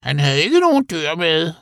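high-pitched creature voice; animal character. A narrator's reading. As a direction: report it in a high little voice. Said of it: "fortsatte Joey". (lys dyrestemme)

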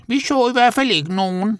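high-pitched creature voice; animal character. A narrator's reading. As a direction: report it in a high little voice. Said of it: "fortsatte Joey". (lys dyrestemme)

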